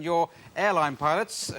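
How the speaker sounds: usual speaking voice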